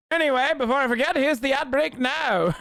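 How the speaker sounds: high-pitched mock British voice; high, theatrical British accent; put-on British accent. Streamer puts on British voice.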